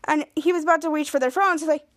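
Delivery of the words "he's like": ordinary talk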